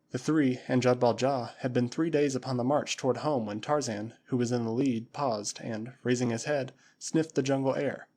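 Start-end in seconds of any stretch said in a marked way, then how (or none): none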